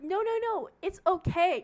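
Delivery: high, loud brushing-off; retold protest. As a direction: imitate an insistent man talking over objections. Man protesting to nurses, retold.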